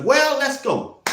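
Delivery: hyped call. Real, not acted. Hyping up linebacker room.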